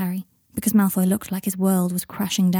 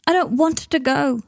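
put-on higher-pitched pleading child; upset child protesting; 7 semitones higher than usual. A narrator's reading. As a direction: plead against being sent away.

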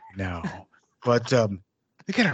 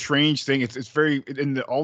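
ordinary talk

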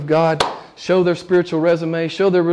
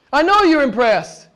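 mildly mocking, higher-pitched boast; self-impressed religious boaster; 10 semitones higher, 4 dB louder than usual. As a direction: imitate someone sure God admires him. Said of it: "These guys are saying".

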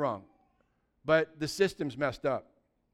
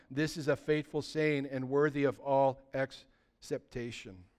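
solemn reading of scripture to the congregation; 4 semitones lower than usual. Acted. Reads scripture aloud.

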